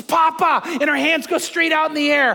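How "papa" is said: put-on higher, louder child's greeting; excited granddaughter. Granddaughter spotting her grandpa, imitated excited call. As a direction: imitate a little girl crying out for grandpa. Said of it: "and she goes".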